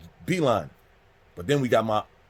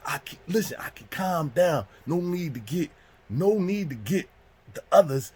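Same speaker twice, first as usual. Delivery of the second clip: higher, drawn-out placating voice; pleading culprit; 6 semitones higher than usual. Retold or imitated. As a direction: try to calm an angry friend down.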